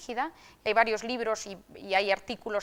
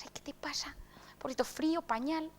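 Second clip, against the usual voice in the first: put-on higher, softer baby-talk to a crying infant; cooing to a baby; 5 semitones higher, 7 dB quieter than usual. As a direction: coo over the baby as a worried mom. Mother soothing crying baby. (madre arrullando con ternura a su bebé que llora)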